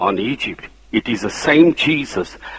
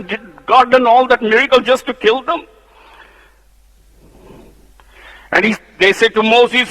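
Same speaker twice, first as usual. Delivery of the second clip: high, loud pulpit fervour; preaching at full force; 11 semitones higher, 4 dB louder than usual. Acted. Preacher rousing the church against the rebels, fervently. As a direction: build the fire in the sermon.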